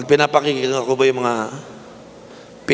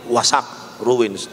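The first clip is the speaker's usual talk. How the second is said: normal talking voice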